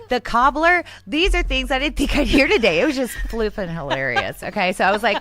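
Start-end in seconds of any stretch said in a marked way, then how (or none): 0.1-0.8 high, loud, disbelieving question; mock disbelief at the cobbler; incredulous. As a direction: echo the word in amazement. Astonished at the cobbler.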